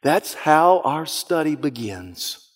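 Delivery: usual speaking voice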